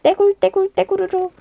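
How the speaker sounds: high playful rolling sound; rolling sound effect. A narrator's reading. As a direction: bounce the words like a tumbling rock. Rock rolling sound word. (데굴데굴 효과음)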